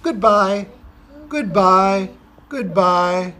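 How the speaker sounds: storyteller waving goodbye in voice; sing-song goodbye. Acted. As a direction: call goodbye brightly to the kids. Reading picture book to children.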